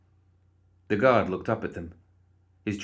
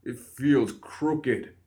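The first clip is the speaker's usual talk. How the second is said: slow, higher, pitiful stuffed-nose complaint; broken-nose voice; 5 semitones higher, much slower than usual. Acted. Tearful guard cradling his nose, stuffed-up complaint. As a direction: whimper it slowly through a blocked nose.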